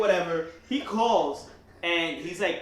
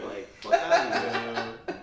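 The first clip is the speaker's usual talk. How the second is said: laughing; 6 dB louder, slower than usual. Real, not acted. Dismissal with laughter.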